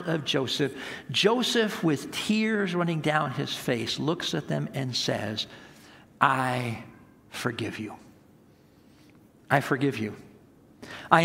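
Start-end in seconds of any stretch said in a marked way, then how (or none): 1.1-5.5 rising, louder preached voice of Joseph forgiving; preaching at full force. Acted. Preacher voicing Joseph forgiving his brothers, loud and rising. 6.2-10.2 tender forgiveness. Acted. Joseph forgiving tearful brothers.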